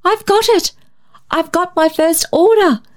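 bright, high excited cry; caroled with joy. A narrator's reading. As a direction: sing out the good news. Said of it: "she caroled".

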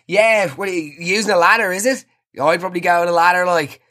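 high, eager blokey banter; overdoing the one-of-the-lads act; hammed-up lad voice. Chatting up the installer with macho bro talk.

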